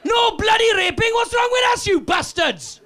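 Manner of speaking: high, furious shout at the Vikings; feisty ancestor shouting down invading Vikings; feisty Welsh woman yelling. Retold or imitated. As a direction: scream it high like an outraged villager. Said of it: "when they came into her village going".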